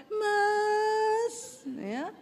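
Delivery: high, long-drawn "mas", eerily sung; overdone sweet Javanese wife call; horror-like sweet call. Shown as a demonstration. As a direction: sing it out slowly and high like a ghost. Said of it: "Kok horor".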